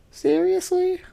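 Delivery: falsetto squeak; a girlish high-pitched question. Acted. Girl asking her professor, falsetto squeak.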